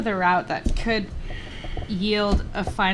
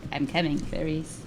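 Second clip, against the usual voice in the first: Hannibal Lecter voice; 6 semitones lower, 8 dB quieter than usual. Retold or imitated. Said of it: "He's like".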